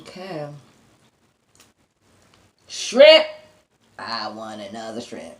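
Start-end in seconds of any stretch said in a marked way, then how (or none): 2.7-3.2 high, loud excited call of the word; excited shout. Real, not acted. Eater greeting the shrimp with an excited loud shout. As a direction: cry out for the food you love.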